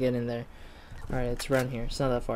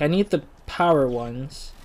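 ordinary talk, nothing marked

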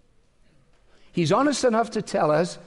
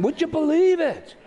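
hammed-up Cleveland accent, pitched way up; exaggerated Cleveland catchphrase, incredulous; 11 semitones higher than usual. Older friend turning him upside down, a broad Cleveland accent. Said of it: "in a very sort of Cleveland voice".

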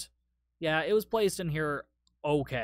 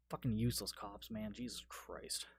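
low, quiet exasperated aside; muttered grumble; 6 semitones lower, 12 dB quieter than usual. Real, not acted. Viewer fed up with useless cops, muttered grumble. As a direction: mutter it under your breath.

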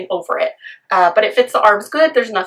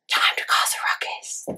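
whisper; 9 dB quieter than usual. Pretend. Voicing the cat's mischief.

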